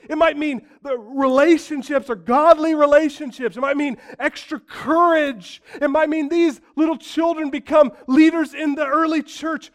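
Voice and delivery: high, loud, full-force sermon; fiery preaching. Acted. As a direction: preach it out with fire to the congregation.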